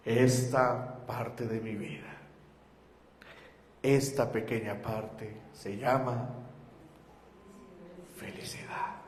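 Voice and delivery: low, slow and hushed, near tears; father moved to tears telling his son, hushed and slow; tearful. Retold or imitated. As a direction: let it fall to a choked breath on the last word. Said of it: "él empieza a llorar".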